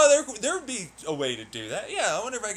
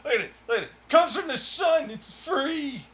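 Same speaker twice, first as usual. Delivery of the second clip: mock believer; 5 semitones higher, 5 dB louder than usual. Mocking free-energy believer again.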